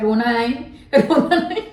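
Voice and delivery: sarcastic imitation of consoling; mocking comfort. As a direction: tease her with a sing-song रोना नहीं. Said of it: "आज हम लोग बोल रहे हैं".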